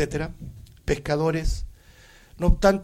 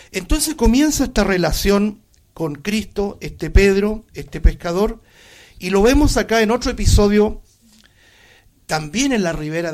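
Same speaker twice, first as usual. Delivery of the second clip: louder, fervent preaching; 5 semitones higher, 5 dB louder than usual. Acted. Pastor preaching to the congregation, louder and fervent. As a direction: drive the point home to the flock.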